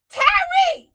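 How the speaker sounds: high, full-volume holler of a name; playful calling out. Pretend. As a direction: holler it like a mama calling a child. Playfully calls out, like a mama.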